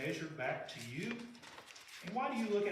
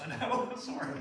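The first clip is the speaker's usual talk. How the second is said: high, louder laughing sorry; laughing apology; 10 semitones higher, 4 dB louder than usual. Real, not acted. Preacher laughing an apology to Deborah for the gross story. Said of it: "I can tell the look on Deborah's face".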